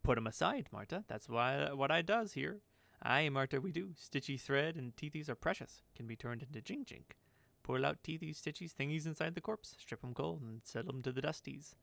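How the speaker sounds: swooping character voice, matter-of-fact madness; eccentric seamstress, businesslike. Acted. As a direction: list the gruesome chores with relish. Voices Marta explaining her trade.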